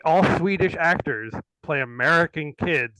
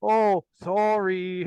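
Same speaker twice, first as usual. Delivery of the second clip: put-on Canadian kid; 5 semitones higher, much slower than usual. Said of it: "And they're like".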